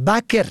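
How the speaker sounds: بکر said "baker"; فتحه on first letter. Shown as a demonstration. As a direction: say بکر with a short a after b. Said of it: "به فتح اول". (بکر با فتحهٔ ب: بَکر)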